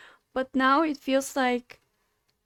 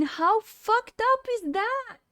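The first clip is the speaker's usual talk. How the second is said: outraged; 9 semitones higher, slower than usual. Real, not acted. Outraged at being banned.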